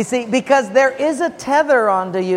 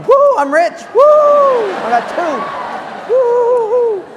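high, loud gleeful whooping; mock joyful whoops; 5 dB louder, slower than usual. Pastor mocks people at the end getting extra wallets, high gleeful whoops. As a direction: crow like a winner who got two wallets. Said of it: "the people in the end are going".

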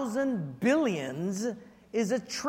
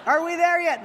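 put-on higher, louder whiny child; nagging kid; 7 semitones higher, 5 dB louder than usual. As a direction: whine it like a bored little kid. Kids nagging while he morphs.

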